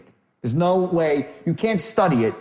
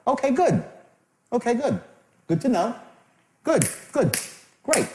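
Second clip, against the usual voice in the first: short higher-pitched acknowledgements; mildly pleased, a notch above neutral; a little upbeat; 7 semitones higher than usual. Shown as a demonstration. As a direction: keep it lightly positive, not overdone. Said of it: "Little upbeat, not too much".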